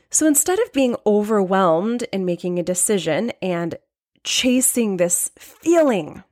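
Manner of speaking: usual speaking voice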